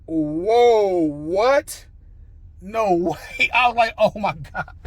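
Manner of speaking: loud, stretched-out astonishment; retold ecstatic disbelief. Applicant hearing academy news, going crazy with amazement. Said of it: "going crazy".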